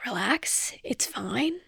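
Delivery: very soft, whispered to oneself; whispered self-soothing. Real, not acted. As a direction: whisper it to calm yourself down. Calming herself while getting emotional.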